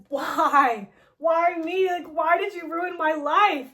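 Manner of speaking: betrayed hero shouting at his maker; anguished outcry. Retold or imitated. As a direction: demand it through pain. Hero confronts the villain.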